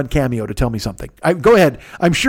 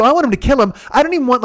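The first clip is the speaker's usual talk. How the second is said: worked-up rant; 7 semitones higher, faster than usual. Retold or imitated. Ranting to Dean, pitch climbing.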